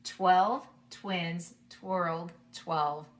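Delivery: very slow, each word spaced out; nice and slow. Shown as a demonstration. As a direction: say it slowly for kids to follow. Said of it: "Real slow, we'll try it".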